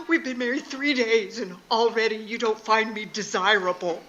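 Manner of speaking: loud, tearful wail, hammed up; mock crying. Rejected new wife to her husband, mock tearful wail. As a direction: wail it as an imitated weepy newlywed. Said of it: "did the silent cry".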